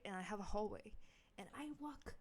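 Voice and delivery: plain reading voice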